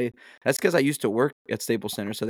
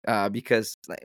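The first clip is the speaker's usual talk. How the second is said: usual speaking voice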